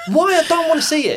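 high, laughing exasperated protest. Real, not acted. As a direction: plead it through a laugh.

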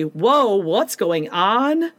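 normal talking voice

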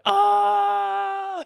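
long, loud, high-pitched goofy scream; silly mock scream. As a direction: let out a drawn-out pretend shriek. Imagines running around being stupid.

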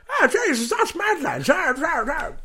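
high, loud screeching imitation; dinosaur-like voice. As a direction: squawk it out like a cartoon dinosaur. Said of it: "He talks like a dinosaur".

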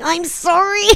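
high, strained mock apology; Russell Crowe. Host mocking an out-of-depth singer, high pleading apology. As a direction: squeak out a comic apology. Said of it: "about one second away from".

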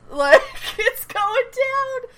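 high, slow, laughing words; laughing excitement. Real, not acted. Cracking up at the scene.

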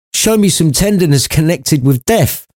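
put-on high old man's voice, asking; Scrooge asking. A narrator's reading. Scrooge asks the spirit for tenderness, high-voiced. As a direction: ask for a gentle scene.

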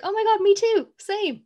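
mock-excited high gush. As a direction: gush like an overexcited friend. Mock gushing agreement quote.

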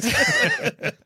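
high squeaky burst of laughter; a shrill giggle escapes; squealing laugh. Real, not acted.

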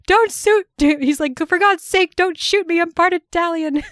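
high, loud, hammed-up panic; mock terror from a cowardly rival; panicked plea. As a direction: plead for your life, pitch way up. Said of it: "The part where Hugh is like".

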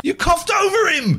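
high, loud accusing shout; mock outrage shout. Real, not acted. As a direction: yell it in playful outrage. Accusing co-host over clip.

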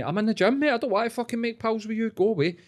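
high-pitched mock protest; put-on pleading irritation; exasperated brush-off. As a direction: act out fending off a pest, pitched right up. Acts out brushing off the pest.

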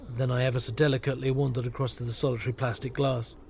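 plain reading voice

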